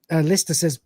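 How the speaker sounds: usual speaking voice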